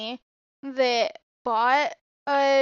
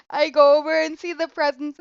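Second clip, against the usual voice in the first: ordinary talk, nothing marked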